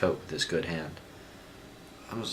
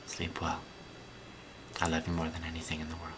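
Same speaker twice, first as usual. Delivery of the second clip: hushed, tender bedtime whisper; softly tuck her in with your voice; tender goodnight; 8 dB quieter, faster than usual. Real, not acted. Parent saying goodnight to daughter.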